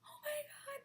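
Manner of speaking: very high, exaggerated tearful fangirl; put-on high weepy squeal; mock bawling. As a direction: imitate a girl bawling with joy. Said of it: "bawling, and she, like".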